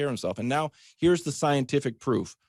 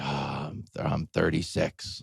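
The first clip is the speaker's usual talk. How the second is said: much lower and softer, a lightly voiced producer; mock producer; 8 semitones lower, 8 dB quieter, slower than usual. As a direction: mumble it low like a sheepish producer. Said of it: "he's like".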